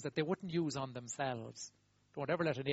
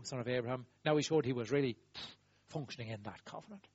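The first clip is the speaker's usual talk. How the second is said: ordinary talk, nothing marked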